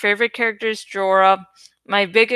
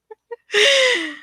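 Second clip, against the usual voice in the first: high giggle; 14 semitones higher than usual. Real, not acted. Laughing at own rambling.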